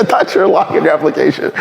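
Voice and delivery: amused giggly retelling; laughing through it. Retold or imitated. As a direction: laugh out the line you're retelling. Retelling the interviewer's surprised remark.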